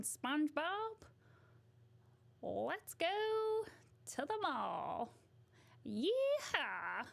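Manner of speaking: high, whooping Texas twang; Sandy. Acted. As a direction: holler like a cartoon Texan cowgirl. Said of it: "Sandy from SpongeBob, she's Texas".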